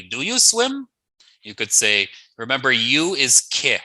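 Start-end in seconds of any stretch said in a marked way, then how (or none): 0.0-0.9 "swim?" lifted high at the end; rising question. Shown as a demonstration. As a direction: ask "Do you swim?" with a big upward lift on swim. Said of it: "So if you wanna ask somebody".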